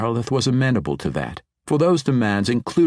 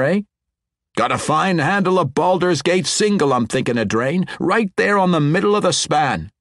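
higher, louder dwarf brogue; hearty dwarf banter; 8 semitones higher, 4 dB louder than usual. A narrator's reading. Two dwarves chatting on the bridge, hearty dwarf voices. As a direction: swap cheerful talk like old dwarf friends.

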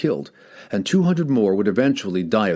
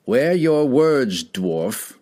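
slow, higher warning that trails off on dwarf; tentative retort; 4 semitones higher, much slower than usual. A narrator's reading. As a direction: bluster it, unsure underneath. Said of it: "retorted Cassius".

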